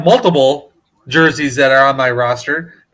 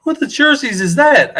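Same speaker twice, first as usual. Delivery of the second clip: retold puzzled question; 8 semitones higher than usual. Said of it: "they're like".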